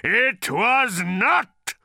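high, slow, furious bellow. A narrator's reading. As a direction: bellow the denial. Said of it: "the answering bellow".

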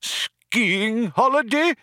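slow, high-pitched disbelief; outraged cry. A narrator's reading. Old man repeats Frankie's suggestion, outraged cry. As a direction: cry it back, drawn out and high. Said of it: "cried the old man".

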